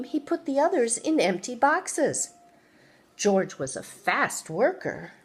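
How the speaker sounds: ordinary delivery, nothing marked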